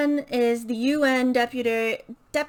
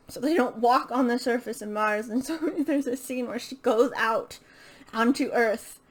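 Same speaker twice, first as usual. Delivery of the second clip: ordinary talk, nothing marked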